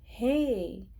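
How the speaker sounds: ordinary delivery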